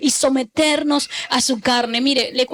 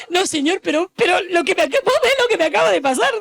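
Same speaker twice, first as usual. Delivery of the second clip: higher, pleading protest; protesting sister; 5 semitones higher, faster than usual. Retold or imitated. Sister protesting to God after being robbed, pleading. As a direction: plead like a robbed woman refusing. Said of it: "decía".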